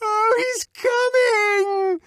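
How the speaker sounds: very high, slow, stretched shriek; frenzied scream. A narrator's reading. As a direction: scream it out in hysterical rapture. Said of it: "screamed Clara Detterding".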